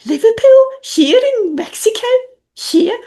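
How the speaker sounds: high, loud, questioning Scouse; exaggerated Liverpool accent, pitched right up. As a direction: riff in mock-incredulous Scouse. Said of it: "riffing in Scouse".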